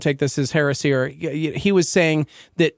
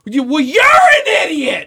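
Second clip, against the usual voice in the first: high, loud, drawn-out yell of an insult; emotional outburst; 11 semitones higher, 6 dB louder, slower than usual. Acted. Acting out losing it in an argument, hammed-up emotional yell. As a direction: blow up in a hammed-up screech. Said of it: "once I get emotional, it's like".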